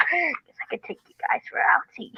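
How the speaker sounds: sneaky unvoiced whisper. Engine whispering alone near the coaches. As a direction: breathe it out secretly.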